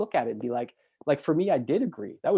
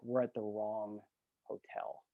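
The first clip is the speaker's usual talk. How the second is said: sheepish confession; 5 semitones lower, 12 dB quieter, slower than usual. Retold or imitated. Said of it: "he's like".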